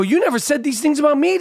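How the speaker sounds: high-pitched mock indignation; indignant comeback. Husband answering his wife, mock indignant. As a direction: throw the complaint back, pitched well up. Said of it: "I go".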